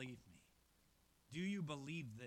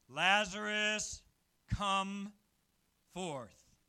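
slow, ringing loud call into the tomb; a commanding shout drawn out slowly; 3 semitones higher, 13 dB louder, much slower than usual. Acted. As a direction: call the dead man out with full command. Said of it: "Jesus says in a loud voice".

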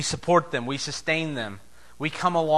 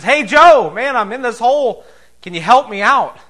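high, loud call to a friend; calling out for help; 8 semitones higher, 11 dB louder than usual. Acted. Man in the hole calling up to his friend, loud and high. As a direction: shout up hopefully to Joe.

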